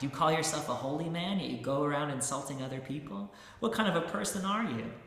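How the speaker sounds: indignant shouting. Acted. Insulted man accusing the holy man, loud indignant shouting. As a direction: climb in pitch as the outrage builds. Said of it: "he cried".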